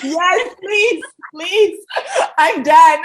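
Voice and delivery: high, loud words through laughter; giggling, pitched well up; laughing. Real, not acted. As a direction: break up laughing as you speak. Host breaks up laughing.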